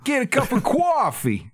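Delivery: put-on high, slow New York accent. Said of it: "That's my New York accent".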